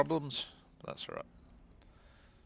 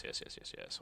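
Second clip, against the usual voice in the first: whisper; 12 dB quieter than usual. Real, not acted. Muttering to himself.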